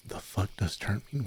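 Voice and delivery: ordinary talk, nothing marked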